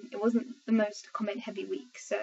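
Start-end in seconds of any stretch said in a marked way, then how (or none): none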